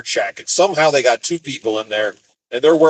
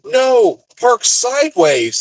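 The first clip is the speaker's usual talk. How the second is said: higher, emphatic mock command; exasperated order; 11 semitones higher, 3 dB louder, much slower than usual. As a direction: imitate a bossy worker, drawing out the correction. Same yard driver corrects him.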